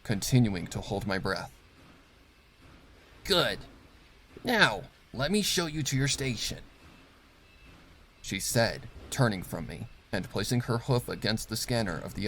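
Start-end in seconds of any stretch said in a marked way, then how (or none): 3.2-6.6 curt, cold orders to a new worker; clipped commands, looking down on him; stern boss mare. Acted. Factory boss inspecting a new worker, cold and curt.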